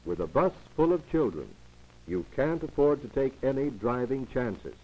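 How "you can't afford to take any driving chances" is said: ordinary delivery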